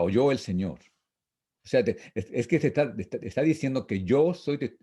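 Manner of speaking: ordinary delivery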